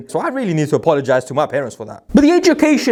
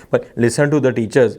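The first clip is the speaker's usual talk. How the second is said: put-on parent's Desi accent; 6 semitones lower than usual. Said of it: "why I'm speaking in that accent".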